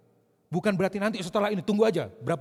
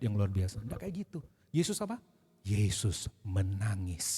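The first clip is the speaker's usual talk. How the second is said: ordinary talk, nothing marked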